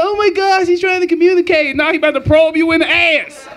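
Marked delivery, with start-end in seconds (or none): 0.0-1.8 put-on higher, quick, wide-eyed kid voice. Kids marveling at E T's glowing finger, excited voices. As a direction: gush like amazed suburban kids. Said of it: "They're like".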